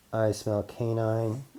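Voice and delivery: usual speaking voice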